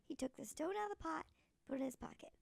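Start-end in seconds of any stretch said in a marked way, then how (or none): none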